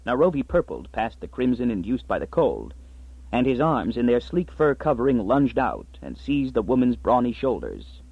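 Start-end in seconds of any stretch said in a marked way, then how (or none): none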